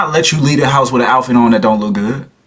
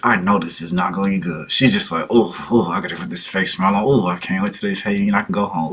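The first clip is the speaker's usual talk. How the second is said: imagined inner thoughts; 3 semitones lower, 5 dB quieter than usual. Retold or imitated. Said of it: "But her, she's like".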